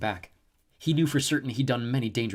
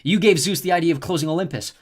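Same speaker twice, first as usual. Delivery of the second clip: louder, higher accusation; accusing; 7 semitones higher, 9 dB louder than usual. A narrator's reading. Jason accusing the snow goddess, raised voice. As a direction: point the finger at her.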